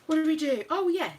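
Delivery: normal talking voice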